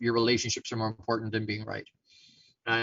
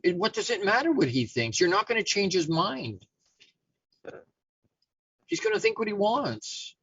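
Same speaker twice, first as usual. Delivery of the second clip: higher-pitched, scolding lion; exasperated lecture; 5 semitones higher than usual. Acted. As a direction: spell it out point by point, pitched up.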